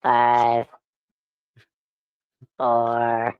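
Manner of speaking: flat robot countdown; glitching robot. Pretend. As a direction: count down like a dying machine. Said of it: "I think he's doing it".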